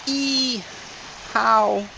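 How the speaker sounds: high, puzzled customer voice. Retold or imitated. Customer asking the clerk, high and puzzled. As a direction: squeak it out like the baffled customer.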